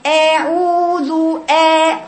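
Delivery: long held vowels in "أعوذ", mouth pulled horizontal; wide wrong fatha. Shown as a demonstration. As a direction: draw out "أعوذ" and "آ" with lips spread too wide. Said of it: "عرضية كده".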